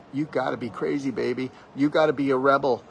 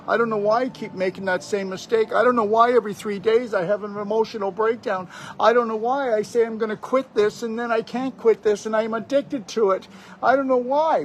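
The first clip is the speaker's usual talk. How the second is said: pitched way up, mock whining about being stuck; whiny complainer; 8 semitones higher than usual. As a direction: play the helpless complainer who never learns. Mimicking stuck, complaining people.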